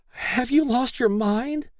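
slow, very high-pitched shocked question; choked-out cry. A narrator's reading. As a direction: choke it out in disbelief. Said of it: "she choked out".

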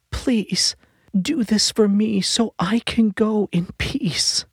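high whispered begging; pained plea. A narrator's reading. Dying wife begs her husband after a moan, high pained whisper. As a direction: beg through the pain.

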